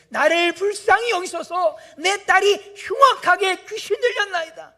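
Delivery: loud, high crying-out plea; mother crying out for her sick daughter; desperate shout. Retold or imitated. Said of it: "소리질러 이르되".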